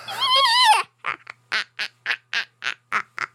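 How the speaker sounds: high shrieking witch cackle. Pretend. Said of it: "I can do a witch laugh".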